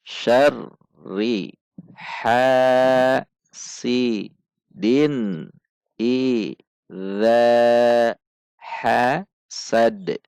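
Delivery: slow chanted tajwid recitation with long held vowels; measured Quranic chant. Shown as a demonstration. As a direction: recite it slowly, stretching the long sounds. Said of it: "Mari perhatikan panjang dan pendek bacaan".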